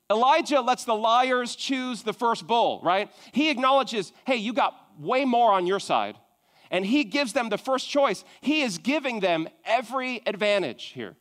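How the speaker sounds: normal talking voice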